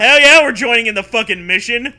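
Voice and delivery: loud, laughing hell yeah; pumped gamer whooping at the plot; excited cheer. Real, not acted. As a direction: cheer like you just got drafted into the fun. Excited reaction to story.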